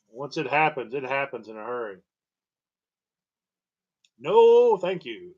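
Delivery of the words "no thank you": high, loud firm refusal; emphatic no. Real, not acted. Turning down infected donor tissue, loud high no. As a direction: slam the door on it.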